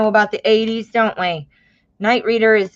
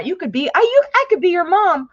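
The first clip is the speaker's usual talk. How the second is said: higher, fast amused disbelief; surprised laugh-line; 8 semitones higher, faster than usual. Real, not acted. Reader realizes she could be viewer's mom, surprised. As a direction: blurt it out in amused shock.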